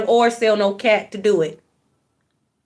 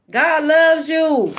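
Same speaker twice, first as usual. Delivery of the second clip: tender; 5 semitones higher, much slower than usual. Real, not acted. Tender reassurance to viewers.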